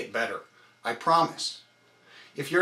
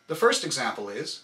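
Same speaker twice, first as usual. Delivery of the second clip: usual speaking voice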